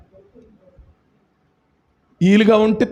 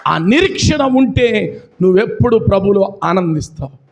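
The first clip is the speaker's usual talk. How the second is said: ordinary delivery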